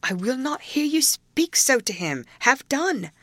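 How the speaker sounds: high-pitched, urgent reproach; reproachful mutter. A narrator's reading. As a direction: reproach her in a lifted, hushing voice. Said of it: "muttered the young man reproachfully".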